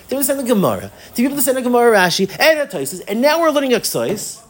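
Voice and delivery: high-pitched list building in amazement; emphatic encouragement. Retold or imitated. Rebbe lists student's progress emphatically.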